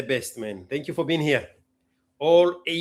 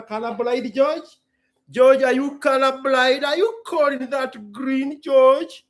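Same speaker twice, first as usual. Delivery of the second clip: higher, teasing scold; mock scolding; 6 semitones higher, 5 dB louder than usual. Scolding George for calling the color green, higher and teasing. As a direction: tease an imaginary friend.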